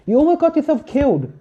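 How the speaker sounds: very high, loud scolding; scolding shriek. Acted. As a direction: tell him off in fright. Hestia scolds Bell.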